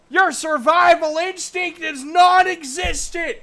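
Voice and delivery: a furious high-pitched voice berating the halfling; shrill scolding. Acted. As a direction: scream at him for his stupidity.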